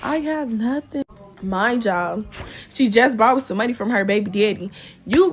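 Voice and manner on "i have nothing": high, slow whiny mock sob; mock crying. Dressmaker caught without a refund, mock crying. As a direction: imitate the dressmaker breaking into fake tears. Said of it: "She get the cry".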